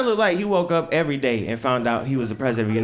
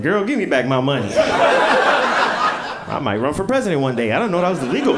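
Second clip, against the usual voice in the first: louder, laughing demand; loud phone demand; 2 semitones higher, 7 dB louder than usual. Retold or imitated. Comic phone call demanding money back. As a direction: yell it into the phone while laughing. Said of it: "I said".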